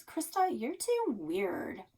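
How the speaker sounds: put-on bored teen sister scolding her little sister; eye-rolling scorn. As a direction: roll your eyes at your weird sister. Sister rolls eyes.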